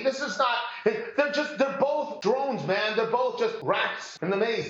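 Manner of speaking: ordinary talk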